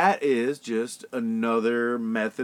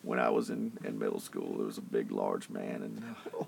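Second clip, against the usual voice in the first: Bill Clinton voice; 5 dB quieter than usual. Pretend. Friend urges him on, so he keeps the Clinton voice. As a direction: sink deep into a husky Clinton confession. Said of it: "keep going".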